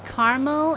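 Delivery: Caramel said "KAR-mul"; two-syllable variant. Shown as a demonstration. Streamer teasing those weirdos, two-syllable pronunciation. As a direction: say Caramel as "Carmel". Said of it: "is like those weirdos who say".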